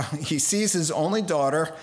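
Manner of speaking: ordinary talk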